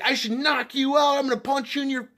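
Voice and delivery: high, loud raging threat; furious threat. Retold or imitated. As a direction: menace him with a punch. Stranger threatens to punch.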